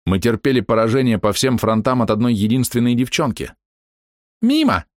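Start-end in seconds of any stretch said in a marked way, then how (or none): 4.4-4.9 high taunt 'Мимо'; mocking miss call. Archer girl jeers at the hero's miss. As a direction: jeer at the missed shot.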